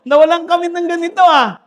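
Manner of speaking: preacher mimics another vendor's complaint with a laugh, mock indignation; mock complaining vendor.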